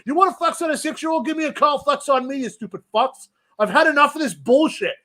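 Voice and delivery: heated rant, pitched high; angry rant. Real, not acted. Ranting on air about the employee who flexed, angry. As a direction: vent the outrage hard.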